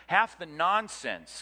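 slow, loud and forceful on "Half the nonsense"; strong emphasis. Real, not acted. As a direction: lean hard into "Half", like a preacher warming up. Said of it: "can I say this strongly?".

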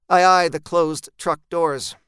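ordinary delivery, nothing marked

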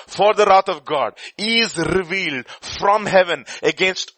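loud, solemn scripture reading. Acted. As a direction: proclaim the verse with weight. Reads Romans aloud.

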